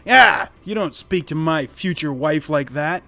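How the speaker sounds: loud outburst in a goblin's voice; indignant goblin. Acted. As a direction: scold them loudly, defending your bride. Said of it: "anybody that speaks goblin, you hear".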